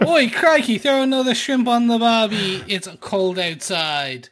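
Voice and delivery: high, hammed-up Aussie call, sinking as it goes; badly faked Australian accent; muddled mock Australian accent. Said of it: "messing your own accent up there".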